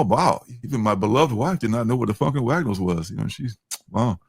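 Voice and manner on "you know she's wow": ordinary talk, nothing marked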